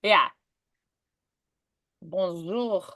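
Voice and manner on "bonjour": Bonjour with a soft French j and throaty R. Shown as a demonstration. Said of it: "you add a little in there".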